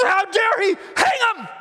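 high, hammed-up royal shout; enraged king. Enraged king orders Haman hanged, hammed-up shout. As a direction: shout the order in mock fury. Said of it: "the king is so enraged".

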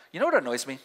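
ordinary delivery

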